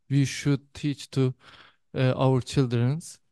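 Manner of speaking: normal talking voice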